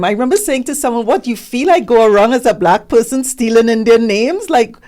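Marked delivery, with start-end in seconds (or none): 1.1-4.5 loud, higher-pitched incredulity; acted-out affronted comeback, raised voice; retold indignant retort. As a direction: throw it back loudly, as if absurd. Retort to someone questioning her name.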